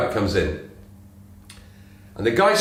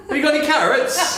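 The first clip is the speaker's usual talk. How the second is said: much higher rabbit voice, laughing through it; laughing rabbit delivering the punchline; 5 dB louder than usual. Acted.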